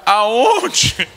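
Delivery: mother asking. Retold or imitated. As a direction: ask tenderly like a fussing mom. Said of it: "a mãe pergunta". (voz de mãe aguda)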